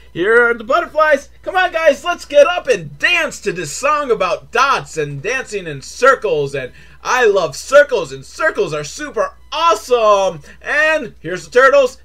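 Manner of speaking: put-on high, gushing children's show host; mock peppy kids' cue. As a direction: get the kids dancing with fake glee. Mimics dance cue for children.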